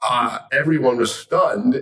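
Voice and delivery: ordinary delivery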